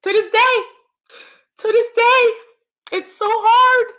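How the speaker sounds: high, strained voice of grief; aching grief. Real, not acted. Talking about [name]'s death in high strained grief. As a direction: push the words out through the ache. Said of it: "it's so hard for me to talk about [name]".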